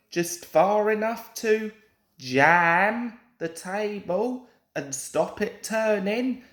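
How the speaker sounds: ordinary delivery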